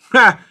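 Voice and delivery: one loud sharp laugh; loud burst of laughter. Real, not acted. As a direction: let out a big real laugh. Laughing at a funny chat comment.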